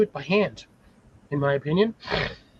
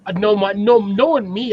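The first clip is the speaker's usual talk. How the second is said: usual speaking voice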